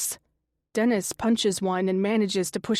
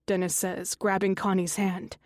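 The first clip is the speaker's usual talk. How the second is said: ordinary delivery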